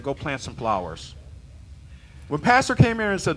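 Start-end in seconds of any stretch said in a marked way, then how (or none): 0.0-1.1 much lower and softer, calm order; calm instruction. Retold or imitated. Preacher quoting pastor's calm answer to panic, low and soft. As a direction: quietly tell them to plant flowers. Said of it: "he said".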